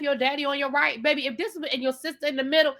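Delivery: ordinary talk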